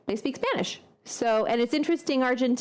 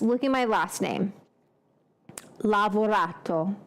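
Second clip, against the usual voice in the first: usual speaking voice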